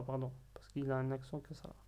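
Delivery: ordinary talk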